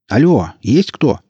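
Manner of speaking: slow loud call into the hatch. A narrator's reading. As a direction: call out into an empty room. Calling into hatch.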